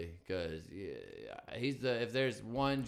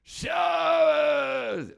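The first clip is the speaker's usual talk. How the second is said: much higher, much louder yell; mock shout; 11 dB louder than usual. Comedian re-shouting his punchline, half laughing. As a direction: hurl the word out laughing.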